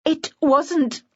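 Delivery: halting words that fail to finish; stammering. A narrator's reading. Narrator accused before the party, hesitant stammered denial. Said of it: "I stammered".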